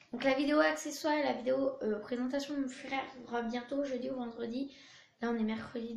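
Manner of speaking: ordinary talk, nothing marked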